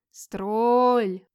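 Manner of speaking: loud, long-drawn eerie wail; loud drawn-out howling call; monster's howl. Acted. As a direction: howl it like a beast outside, stretching the vowel. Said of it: "опять завыла на дворе".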